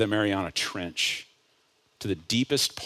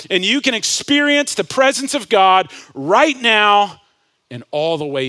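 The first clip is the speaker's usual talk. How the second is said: climbing higher and louder with every line; full-force preaching; 9 semitones higher, 9 dB louder than usual. Real, not acted. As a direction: build to a shouted altar-call climax. Builds to full preaching climax.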